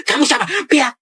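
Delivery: ordinary talk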